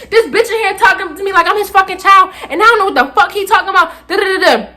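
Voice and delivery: high, quick aggrieved rant; indignant complaint. Retold or imitated. Student complaining to mom about staff, high indignant rant. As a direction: rattle off the grievance to mom.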